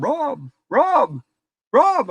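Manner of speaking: high calling Rob, Rob, Rob from a distance; dad calling out. Retold or imitated. Dad calling his son from down in the cellar, high calling out. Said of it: "I hear my dad".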